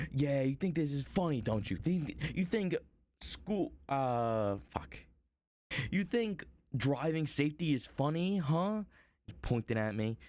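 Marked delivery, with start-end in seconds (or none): 0.1-8.8 imitating an angry father, pitched higher; mock furious accusation, stumbling; hammed-up rant at the guy in the audience; angry man. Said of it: "he's, like, pissed".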